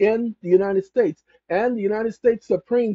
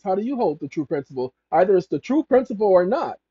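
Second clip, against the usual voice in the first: ordinary talk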